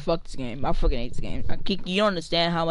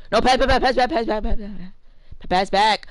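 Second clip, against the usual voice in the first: loud, higher, rapid calls for the ball; calling for the pass; 6 semitones higher, 5 dB louder, slower than usual. Real, not acted. Gamer yelling at a teammate for the pass, loud calls. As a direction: bark for the pass over and over.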